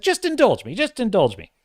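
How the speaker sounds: high, loud, pleading Quark voice; coaxing Quark. Retold or imitated. Quark urging Garak to try the drink, high and wheedling. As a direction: wheedle it like a pushy barkeep. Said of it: "He's like".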